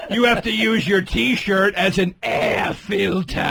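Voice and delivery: very slow, dragged-out words with bent Rs; mocking a bad accent. Mocking cohost's accent, slow and bending Rs. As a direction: imitate a friend's clumsy accent, hamming it up. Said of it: "doing an accent as just bend the R".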